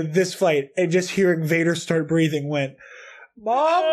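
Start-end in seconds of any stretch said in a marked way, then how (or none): 3.4-3.8 high-pitched, hammed-up plea for mom; mock scared kid. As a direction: play a frightened child calling out. Retold scared plea as Vader appears.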